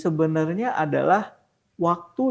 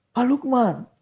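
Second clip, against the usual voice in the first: put-on higher-pitched old man's voice; elderly man's accent; 7 semitones higher, much slower than usual. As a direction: imitate an elderly friend talking. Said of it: "dengan logat-logat udah tua gitu". (logat orang tua)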